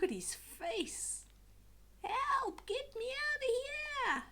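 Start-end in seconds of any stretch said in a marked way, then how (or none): 2.0-4.2 very high, panicked squeal for help; frightened cry. Acted. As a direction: squeal like a scared little monster. Burple afraid of dragon.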